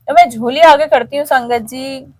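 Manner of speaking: usual speaking voice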